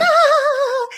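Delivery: a sudden high, loud, excited "Ah!"; imitating a bubbly rental clerk's delighted shriek; cheery chipper squeal. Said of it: "all cheery and chipper".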